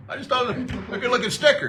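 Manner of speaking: high, quick, innocent dad voice; imitating dad's naive cheer; clueless dad. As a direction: shrug it off happily.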